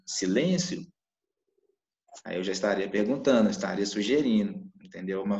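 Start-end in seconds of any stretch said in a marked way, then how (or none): 0.0-0.8 "silêncio" said as a question, rising at the end; asking silêncio. Shown as a demonstration. Teacher shows students "silêncio" as a question, rising tune. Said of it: "e se eu falasse, por exemplo, assim".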